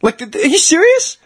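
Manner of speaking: high-pitched, rising outrage; retold incredulous protest. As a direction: plead in disbelief with an official. Retold protest to the ref supervisor.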